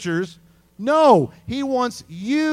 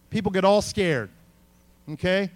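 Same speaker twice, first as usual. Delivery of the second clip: ordinary talk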